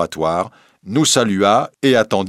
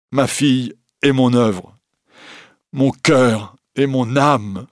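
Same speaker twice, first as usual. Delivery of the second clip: grieving lament; 3 semitones higher than usual. A narrator's reading. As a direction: mourn each loss in turn. Professor laments his stolen work.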